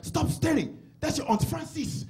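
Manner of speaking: high-pitched imitation of an African father; scolding dad. Dad catching his son staring, mock scolding. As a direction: imitate dad's sharp, accented reprimand. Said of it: "my dad grabs me".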